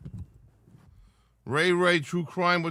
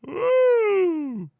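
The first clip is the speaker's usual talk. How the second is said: loud high yawn that breaks out; big yawn; 3 dB louder than usual. Real, not acted. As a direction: let a huge yawn escape. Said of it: "that's a lot of yawning going on".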